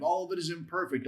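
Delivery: ordinary delivery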